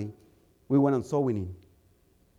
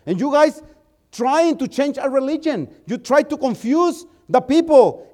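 angry; 7 semitones higher, 4 dB louder than usual. Retold or imitated. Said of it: "She got angry".

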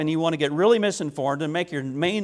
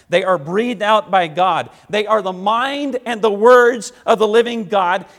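louder, higher, rising to a pulpit cry; full-force preaching; 4 semitones higher, 8 dB louder than usual. Acted. Pastor rebukes the church for wanting stories, preaching at full force. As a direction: preach it hard to the congregation.

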